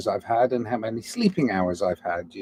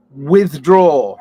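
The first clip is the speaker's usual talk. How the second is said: loud retraction; 3 semitones higher, 10 dB louder than usual. Real, not acted. Retracting remark.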